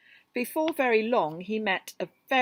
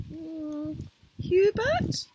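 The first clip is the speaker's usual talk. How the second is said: high, questioning guess; small porcupine guessing high; tentative suggestion; 9 semitones higher, 4 dB louder, much slower than usual. Acted. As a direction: try a name out hopefully. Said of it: "suggested Fluffy".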